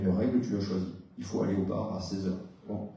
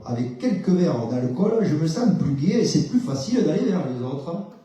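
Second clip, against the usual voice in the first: sing-song Southern French accent, a bit louder and laughing; 5 semitones higher, 5 dB louder than usual. Shown as a demonstration. Therapist demos the thought in a Southern French accent. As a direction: roll it out with a Marseille lilt. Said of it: "me le dire avec un autre accent".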